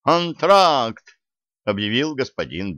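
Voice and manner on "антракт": announcing. A narrator's reading. Announcer calls intermission.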